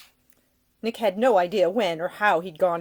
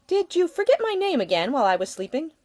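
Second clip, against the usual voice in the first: playful lady's voice, raised pitch; teasing young woman; 10 semitones higher, 5 dB louder than usual. Acted. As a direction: tease him with a smile. Ida teasing Nick.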